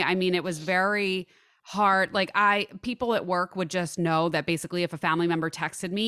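usual speaking voice

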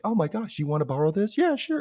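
mock chirpy agreeableness; too-perfect siblings. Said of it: "They're like".

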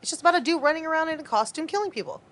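high, animated and insistent; excited protest. Real, not acted. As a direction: push back, amazed they never saw it. Pitch up, arguing.

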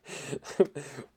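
real burst of amused laughter. Real, not acted. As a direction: crack up at your own slip. Reader laughs at own misreading.

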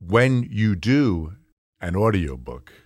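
very slow, measured delivery with pauses; slow audiobook pace. Shown as a demonstration. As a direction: demonstrate how slowly an audiobook is read. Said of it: "you speak at about this speed".

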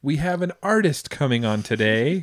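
put-on high, loud kid announcer, drawn out; little boy podcast host. Dad imitating his son announcing the guest, little-kid host voice. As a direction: play a small child introducing the guest. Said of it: "my little guy comes out here".

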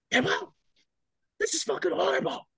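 very high, strained mock scream; hammed-up, high screechy rant; imitated raging corporal. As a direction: mimic a superior losing his mind. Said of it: "loses his fucking mind on me".

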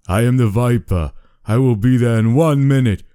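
put-on high, loud menacing accent; creepy Viper voice. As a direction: menace at its peak, Austrian accent. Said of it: "said the voice".

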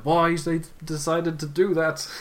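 slow, high, baffled question; slow puzzled crowd voice. Retold or imitated.

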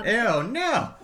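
sudden high, loud disgusted ew; grossed-out ew. Real, not acted. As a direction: recoil at the gross idea. Joke offer to eat floor fish.

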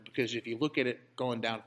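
ordinary talk

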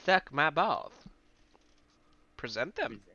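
lightly voiced cartoon schoolteacher; Mister Garrison voice. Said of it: "that's something Mister Garrison would say".

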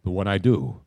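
low, suave pitchman voice, unhurried pauses; Most Interesting Man. Retold or imitated. Radio host quotes the beer ad's tagline, deep Most Interesting Man voice. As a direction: drop deep and deliberate for the famous tagline. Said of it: "See, I can do that".

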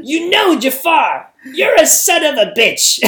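high, loud parrot squawk; Gilbert Gottfried. Acted. Parrot in Aladdin cursing Jafar, high loud squawk. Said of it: "the parrot in Aladdin".

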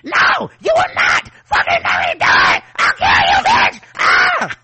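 shrieking hysterical meltdown; screaming Karen. Retold or imitated. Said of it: "videos at Karen at Whole Foods".

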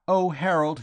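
put-on high, loud girlish cry; emotional cry. A narrator's reading. Girl flinging herself at Vowles, crying out. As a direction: cry out to a lost sweetheart. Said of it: "she cried".

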